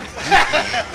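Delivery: loud burst of laughter; big laugh. Real, not acted. Cast member laughing as the play ends, loud. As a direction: laugh out at the finish.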